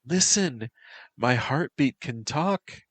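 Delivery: put-on high, light and teasing young woman; playful Emmy voice. A narrator's reading. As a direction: voice her lifted and playful, like a tease.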